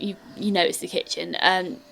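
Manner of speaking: ordinary talk, nothing marked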